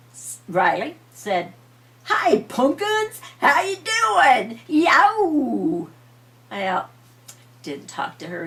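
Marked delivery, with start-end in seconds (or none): 2.0-5.9 put-on high, slow, sweet girl calling out; little girl voice. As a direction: play the only little girl, bright and drawn out. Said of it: "the only girl of the seven".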